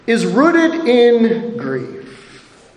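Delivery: is rooted in grief, each word drawn out very slowly; slow, weighty. Real, not acted. Preacher repeats key phrase.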